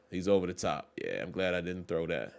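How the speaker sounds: ordinary talk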